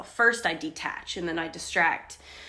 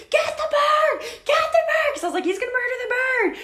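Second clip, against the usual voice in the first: high, loud frantic shriek, much higher and louder; panicked screaming; 6 dB louder than usual. Retold or imitated. Wife screaming at husband to save the bird from the cat, high frantic shriek. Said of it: "I started screaming".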